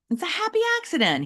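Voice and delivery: high, quick chirpy reassurance; a cheery teacher soothing an upset kid; upbeat Mister Molinari. Acted. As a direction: brightly talk the boy down. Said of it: "he said".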